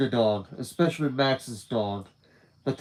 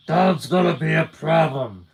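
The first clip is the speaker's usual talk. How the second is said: gumshoe voice; 4 semitones higher, 6 dB louder than usual. Acted. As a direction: growl it like an old noir gumshoe. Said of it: "I gotta do my gumshoe voice".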